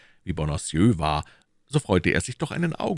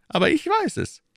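higher, pressing questions as the Cardinal; Cardinal questioning; 3 dB louder than usual. Acted. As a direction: interrogate his agent coolly. (der Kardinal, verhörend)

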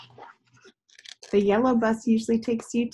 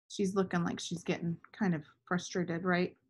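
ordinary delivery

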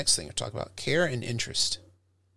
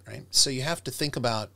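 ordinary talk

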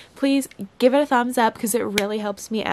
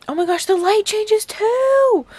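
high, loud thrilled exclamation; genuinely overjoyed cry; excited shout; 10 semitones higher, 10 dB louder than usual. Real, not acted. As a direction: shout out the discovery with glee. Discovering camera features.

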